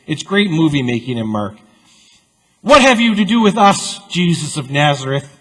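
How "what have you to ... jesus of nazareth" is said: high, loud demonic snarl; seething demon. Acted. Preacher voicing the demon confronting Jesus, seething. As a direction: spit it out like a cornered demon. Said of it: "seethes the demonic power".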